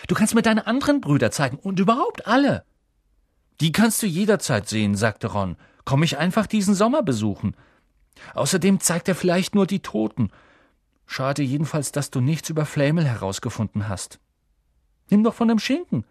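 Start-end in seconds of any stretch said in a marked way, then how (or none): 0.1-2.7 put-on high-pitched, excited boy; climbing high with keen enthusiasm; eager excitement. Boy eager to meet friend's family. 3.6-5.0 put-on casual boy. Narrator voicing Ron. 5.8-16.0 higher-pitched casual schoolboy; light, high-voiced friendly chatter; chatty boy friend. Narrator voicing Ron.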